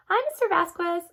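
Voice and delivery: high, bright greeting; friendly girls. A narrator's reading. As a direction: greet the neighbor cheerfully. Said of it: "familiar voices".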